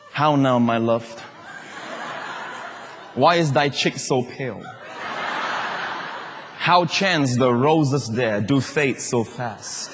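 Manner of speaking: slow, lightly theatrical recitation; mock Shakespeare. Preacher showing husbands reciting poetry, slow and mock-romantic. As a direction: recite like a lovestruck poet, tongue in cheek. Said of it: "That is from William Shakespeare".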